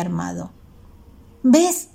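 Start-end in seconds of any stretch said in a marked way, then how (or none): 1.4-1.9 put-on high, louder angry girl's yell; furious shout. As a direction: snap it at the classmate like an accusation. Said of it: "gritó otra niña furiosa".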